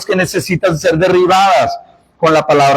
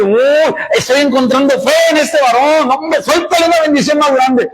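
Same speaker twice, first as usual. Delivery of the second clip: higher-pitched, thrilled exclamation; delighted God; 6 semitones higher than usual. Acted. God rewards a man who refused Sunday work, acted higher-pitched delight. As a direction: play God marvelling at a faithful man. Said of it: "Dios le va a decir".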